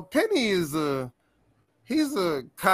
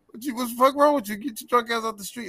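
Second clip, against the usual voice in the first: high fast indignant shout; angry driver; 7 semitones higher, much faster than usual. Retold or imitated. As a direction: yell at the drunk like the driver did.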